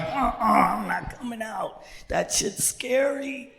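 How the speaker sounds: high, slow, timid creature voice; scared little character. Acted. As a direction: refuse in a small frightened voice. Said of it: "My own caca got so fucking scared".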